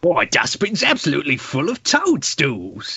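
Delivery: Cockney vaudeville patter; Cockney accent. Acted. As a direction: play the gag as a stage Cockney. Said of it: "Gonna do a Cockney one".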